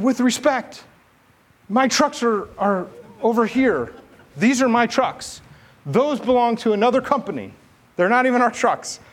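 high, strained "with respect" to the commander; polite correction. Retold or imitated. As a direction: correct the boss carefully and politely.

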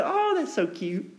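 high, soft mock coo at a snake. Preacher mocking someone who coos at a snake, high and soft. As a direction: coo like it's adorable, sarcastically. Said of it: "found a snake and said".

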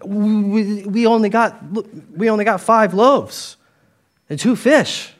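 a panicked disciple pleading with Jesus; flustered disciple. Retold or imitated. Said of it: "This is me responding".

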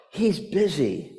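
put-on very soft, small child's voice; little boy. A four-year-old answers the pastor, very soft.

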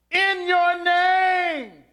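"In your name" shouted high and drawn out slowly; stress on in your name. Shown as a demonstration. Preacher proclaims the original text's emphasis, shouting "In your name". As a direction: ring out "In your name" as the climax of the line. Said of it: "the emphasis was "in your name".

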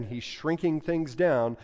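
normal talking voice

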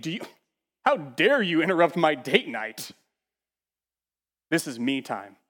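dismissive, put-upon diner brushing off an emergency; hammed-up entitled shrug of a voice; mock selfish whine. Preacher mocking a doctor who won't help, entitled whine.

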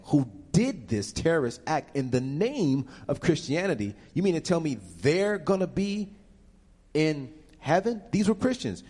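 high, rising incredulous protest. Real, not acted. Speaker questioning Klan leaders in heaven, incredulous. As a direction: build in pitch to outraged disbelief.

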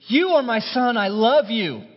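high, loud joyful proclamation; a father's public blessing; proclaiming. Acted. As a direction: announce it with delight. Father's affirmation voiced.

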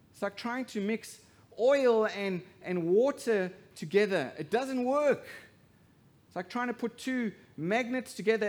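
loud, high preaching build; rising, forceful sermon voice; full-force preaching. Acted.

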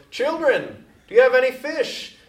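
high, loud call across the water; yelled from shore. Retold or imitated. Jesus calling to the fishermen in the boat, high, loud yell. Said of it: "someone yelled from the shore".

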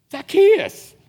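high, loud call of the name; calling up into a tree. Acted. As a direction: call him down from the branches. Jesus calls up into tree.

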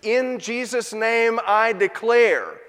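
pompous incantation with a stretched "declare"; mock incantation. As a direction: ham up a false teacher's decree. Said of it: "like some sort of mystical incantation".

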